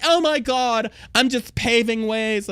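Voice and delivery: high, slow, hammed-up gush; mock gushing model. Mocking the cover model's self-praise, high and slow. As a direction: ham up a self-congratulating sigh of pride. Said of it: "the model's like".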